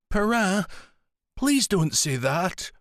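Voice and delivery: put-on begging like a little girl; little girl's voice. A narrator's reading. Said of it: "in the voice of a little girl".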